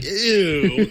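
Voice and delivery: high, squeaky laughing ew; mock disgust. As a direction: tease him with a squeamish ew. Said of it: "I heard you were like".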